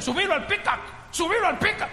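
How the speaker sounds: mock cop ordering him onto the truck; police order. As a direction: pastor imitating police ordering the boy into the pickup.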